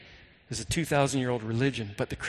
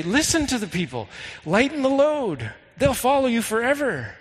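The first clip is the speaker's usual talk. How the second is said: earnest raised-pitch urging; urging advice; 7 semitones higher than usual. Retold or imitated. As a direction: plead the elders' case to the king. Acting out elders urging the king.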